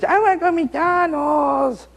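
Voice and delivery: put-on slow, high, whining child's voice; a child begging for McDonald's in a high whine; whiny kid. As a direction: stretch it out like a pleading little kid.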